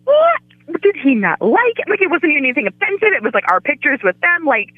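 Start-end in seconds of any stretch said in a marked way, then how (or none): 0.1-1.9 retold shock. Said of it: "we were like".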